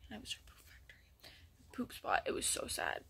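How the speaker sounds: hushed whisper; whispered aside. Real, not acted. Sad memory of the bird's cage, whispered. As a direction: drop to a whisper. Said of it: "whispered aside".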